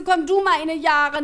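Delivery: put-on high, slow, emphatic old-lady voice; grandma retort. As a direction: draw it out as granny's comeback. Grandma answers being called scatterbrained.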